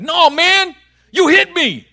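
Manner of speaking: high, loud accusation; angry. Acted. Josh accusing his friend of hitting him, angry. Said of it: "And Josh is angry".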